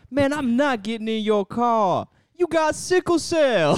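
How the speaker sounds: high, slow, drawn-out refusal; mock radio ad character. Host merges two radio ads, hammy high-pitched protest. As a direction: play the guy in the car, hammed way up. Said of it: "merged that with the guy in the car".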